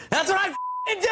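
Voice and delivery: high, indignant mock shout; mock outraged retort. David Spade quoting Adam snapping back at Rob, in mock outrage. Adam's angry retort retold.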